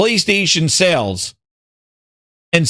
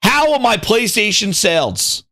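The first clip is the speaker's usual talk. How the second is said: high, slow, emphatic demand; Satya, mock outrage; 6 semitones higher, slower than usual. Mimicking the CEO dismissing Game Pass, mock outrage. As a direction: lay down the law slowly, pitched up. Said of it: "Satya's like".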